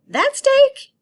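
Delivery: very high, loud mock disbelief; incredulous kid. Child at dinner asking Mom, high and loud. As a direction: squeak out that steak like a greedy kid. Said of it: "you point at it and you go".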